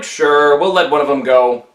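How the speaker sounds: gruff taskmaster. Acted. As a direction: play the bored guard, voice dropped low. Taoist taskmasters agreeing to Monkey.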